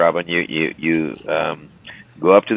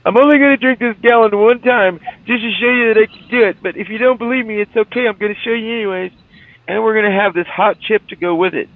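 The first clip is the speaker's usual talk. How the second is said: much higher put-on boasting voice; boastful challenger voice; 12 semitones higher than usual. Streamer plays the milk challenger, high boastful voice. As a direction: brag like the man about to chug the gallon.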